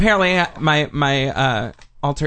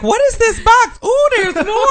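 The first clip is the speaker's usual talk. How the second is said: surprised guests; 5 dB louder than usual. Retold or imitated. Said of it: "They're like".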